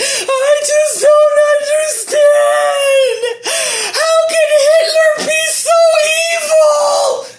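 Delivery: high, loud hammed-up fake sob; mock crying. Mocking people crying online about Hitler, hammed-up wail. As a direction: wail it like an overdone crybaby. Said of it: "you crying on the fucking internet".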